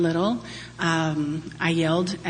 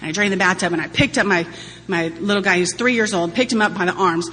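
ordinary talk

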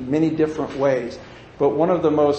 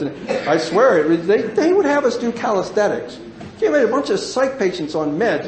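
ordinary delivery

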